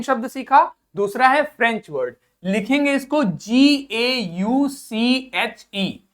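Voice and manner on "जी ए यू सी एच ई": letters spelled out one by one, slowly; spelling out slowly. Shown as a demonstration. Teacher slowly spelling the word for students. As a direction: dictate each letter to the class. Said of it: "क्या लिखेंगे?".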